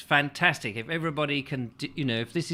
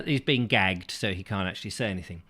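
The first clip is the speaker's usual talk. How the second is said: ordinary talk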